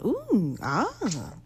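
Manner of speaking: a put-on cartoon moan, high then falling; mock cartoon noises. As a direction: imitate cartoon characters' moaning sounds. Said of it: "making these fucking sounds and going".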